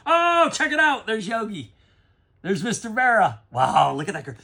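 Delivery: high, loud excited outburst at a great card; can't contain the joy at the find; collector's delighted yelp; thrilled discovery. Real, not acted. Finds a prized baseball card.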